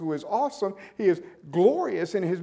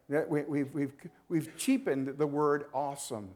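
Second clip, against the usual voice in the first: ordinary talk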